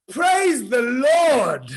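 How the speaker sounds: loud, slow shout of relief; relieved cry. Acted. Rider at the cliff edge crying out in relief.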